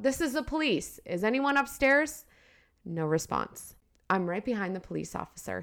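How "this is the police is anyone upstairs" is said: calling out. Acted. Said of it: "He calls out".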